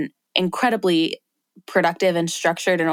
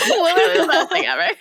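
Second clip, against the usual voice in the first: laughing delight; 11 semitones higher, faster than usual. Real, not acted. Gushing while laughing at friend.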